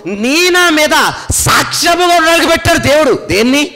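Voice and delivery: high-pitched, emphatic sermon climax; forceful preacher driving the point home; impassioned preaching. Real, not acted.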